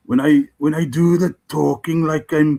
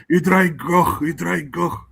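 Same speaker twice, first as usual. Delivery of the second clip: a Welsh accent, the first one higher; 4 semitones higher, slower than usual. Shown as a demonstration. Showing how the Welsh voice goes, in a Welsh accent. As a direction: fling the insult in a Welsh accent. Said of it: "and then you go".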